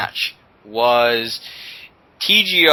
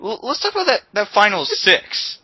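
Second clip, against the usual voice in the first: hyped; 10 semitones higher than usual. Acted. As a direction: sell it like a big announcement. Hyping up the final six.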